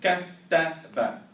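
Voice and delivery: Kattaba drawn out very slowly and loud, falling end; slow model word. Shown as a demonstration. Teacher drilling the class, slow and loud. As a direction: stretch every syllable of Kattaba.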